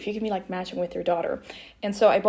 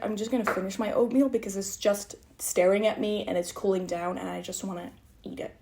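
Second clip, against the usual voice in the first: ordinary talk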